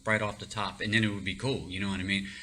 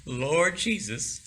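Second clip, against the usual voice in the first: drawn-out prayer; 5 semitones higher, much slower than usual. Retold or imitated. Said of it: "on my knees, in prayer".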